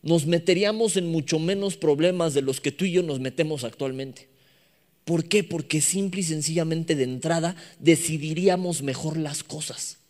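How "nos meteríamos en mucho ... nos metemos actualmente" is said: normal talking voice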